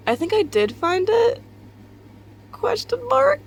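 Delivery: squeaky rising question; high questioning voice. Pretend. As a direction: pitch way up, make it a question. Mock-questioning her own statement.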